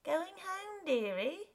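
high, coaxing streetwalker voice; woman's coaxing call. Acted. Street woman accosting a stranger, high and coaxing. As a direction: lure him in, pitched high.

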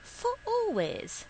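very high, pleading question that falls away; high-voiced woman pressing for an answer; insistent repeated question. A narrator's reading. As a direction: start far up high, then drop. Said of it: "she asked again".

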